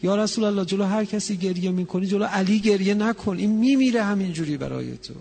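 loud, high, pleading cry; anguished plea. Acted. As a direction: beg him not to weep in front of you. Ali pleading with the Prophet.

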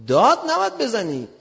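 high, loud rebuke; retold protest at being yelled at; indignant objection. Retold quarrel with officer.

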